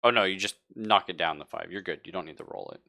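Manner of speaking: ordinary talk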